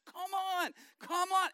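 high, loud insistent come on; urging call. Retold or imitated. Pastor calling the crowd forward for minutes, high and loud. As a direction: beckon the crowd, pushing hard.

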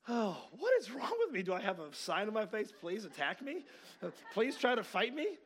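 high, loud mock disbelief; sarcastic self-pitying cry; exasperated outburst. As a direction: throw up your hands, half-joking. His exasperated inner thoughts.

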